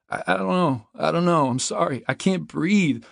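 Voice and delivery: high, loud, stammering apology; panicked pleading. A narrator's reading. Trapped man apologises and can't breathe, pleading in panic. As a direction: blurt it out in a panic.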